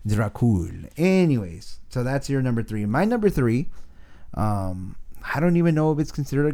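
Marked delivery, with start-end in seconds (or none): 0.1-0.9 lightly voiced slow Romanian accent. Voicing the bearded dragon Dracul in a slow Romanian accent. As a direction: drag out I hate you like a sulky Romanian count. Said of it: "Romanian accent".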